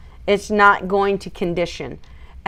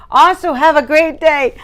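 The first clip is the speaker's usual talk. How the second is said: excited salute; 7 semitones higher, 5 dB louder than usual. Real, not acted. Giving a salute to viewers.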